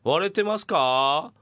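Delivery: slow, stretched-out calling; drawn-out sound check. Shown as a demonstration. Radio host rechecking the distortion, slow drawn-out call. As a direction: draw the question out without shouting. Gentler retest after the overdone shout.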